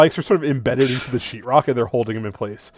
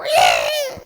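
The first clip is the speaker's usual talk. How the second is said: squeaky death cry. Acted. Crushed creature squeak.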